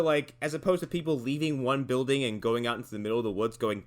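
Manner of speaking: ordinary talk, nothing marked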